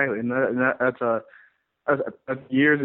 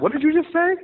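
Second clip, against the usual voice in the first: much higher, louder incredulous what-did-you-say; stunned disbelief; 4 dB louder than usual. Retold or imitated. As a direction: act floored by what you just heard. Retelling reaction to gym partner.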